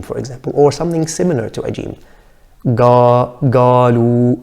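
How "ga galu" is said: galu with a g instead of qaf; galu, qaf turned into g; jim-like error. Shown as a demonstration. Teacher imitating an accent mistake. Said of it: "change the qaf to a jim".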